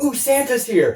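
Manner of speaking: put-on high, thrilled little kid; excited kid voice. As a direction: squeal it like a kid on Christmas morning. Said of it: "he's like".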